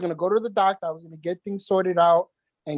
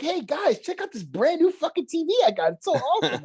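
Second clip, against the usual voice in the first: mock excited; 10 semitones higher than usual. Retold sarcastic boast.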